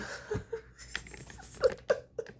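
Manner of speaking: airy high unvoiced laugh; breathy laugh. Real, not acted. Venting alone, laughing at herself breathily. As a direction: laugh through the tears.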